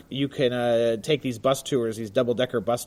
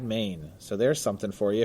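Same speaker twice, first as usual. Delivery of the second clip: usual speaking voice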